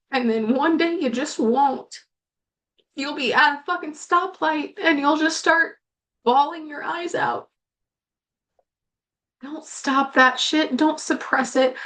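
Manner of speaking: fighting through tears to get the words out; real crying. Real, not acted. Host urges grieving viewers to let tears out, crying. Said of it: "Apparently, I'm gonna cry again right now".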